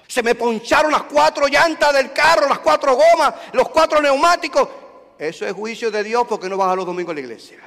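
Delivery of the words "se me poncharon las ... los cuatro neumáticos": higher, louder mock complaint. Preacher imitating a member listing misfortunes. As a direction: ham up a fretting churchgoer.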